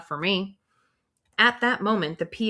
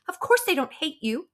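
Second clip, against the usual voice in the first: higher-voiced colleague snapping back; quick retort; 10 semitones higher than usual. Colleague retorting to the teacher's complaint, quick and higher. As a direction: fire it back fast. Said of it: "Miss Fountain shoots back".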